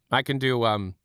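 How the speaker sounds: ordinary delivery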